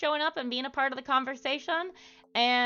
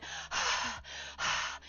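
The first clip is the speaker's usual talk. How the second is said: exasperated sigh; 7 dB quieter than usual. Retold or imitated. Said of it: "and just went".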